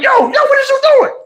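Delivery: very high shouted call. Retold or imitated. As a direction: yell after the brother running off. Calling after fleeing brother.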